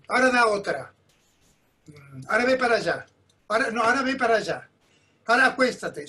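diva imperiosa imitada, dando órdenes; Doña Lola. Retold or imitated. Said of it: "apareció Doña Lola como una reina". (diva mandona, imitada)

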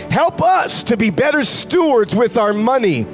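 ordinary talk

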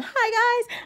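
high, perky vlogger hello; energetic greeting. Shown as a demonstration. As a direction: chirp it like an upbeat video intro. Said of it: "I'm energetic".

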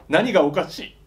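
a touch of irritation in the quote; lightly annoyed, a little sharp; slightly angry. Acted. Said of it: "少し怒っていた".

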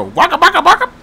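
Pac-Man waka sound. Pretend. Imitating Pac-Man's sound.

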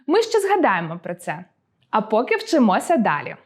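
usual speaking voice

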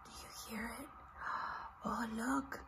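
hushed, secretive whisper; whispered wonder, almost silent. Acted. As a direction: draw them in with a breathy hush. Whispering to children.

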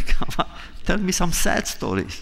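lightly acted plea to a joking friend; mock pleading. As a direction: beg him half-laughing to stop. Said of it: "I would plead with him".

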